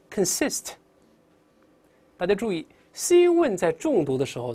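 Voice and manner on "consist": "consist" read out with weak first syllable; clear model reading. Shown as a demonstration. Teacher modelling a word for students, clear model reading. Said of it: "大声来读一下".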